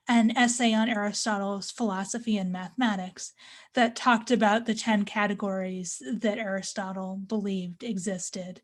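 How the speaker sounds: usual speaking voice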